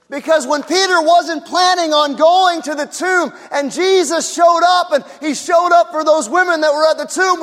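loud, high, ringing preaching; full-voiced pulpit climax; preaching at full force. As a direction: drive it home to the congregation.

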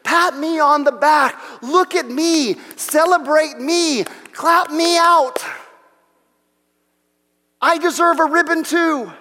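high, loud show-off bragging; mock braggart. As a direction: beg for applause like a bragging child. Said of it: "we do that because we say".

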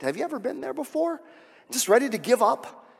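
ordinary talk, nothing marked

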